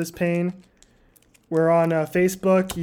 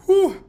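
high loud blowing whew; pained whew; 11 semitones higher, 7 dB louder than usual. Real, not acted. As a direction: puff out the burning heat. Blowing out chili heat.

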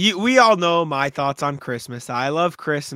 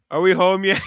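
put-on drunk loud question; 4 semitones higher, 6 dB louder than usual. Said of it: "couldn't keep his voice down".

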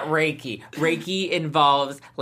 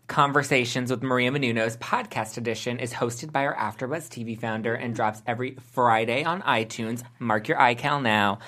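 ad read; 5 semitones lower, 3 dB quieter than usual. Acted. Hosts read a podcast ad.

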